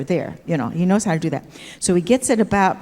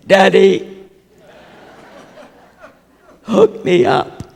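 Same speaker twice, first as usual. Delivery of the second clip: playful call to Daddy; 2 semitones higher, 8 dB louder, much slower than usual. Retold or imitated. Bride calling her husband from the bathroom, girlish and loud. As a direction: sing out to him like a coy little girl.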